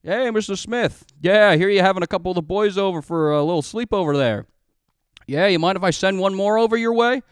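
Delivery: butter up the other father, loud and chummy; dad on the phone. Retold or imitated. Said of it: "I'm using fake names".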